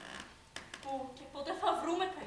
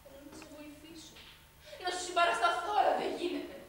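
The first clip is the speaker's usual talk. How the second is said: acted maid's plea; 3 semitones higher, 3 dB louder than usual. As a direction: hold back, pleading without hope. Two maids' role-play. (συγκρατημένη ικεσία)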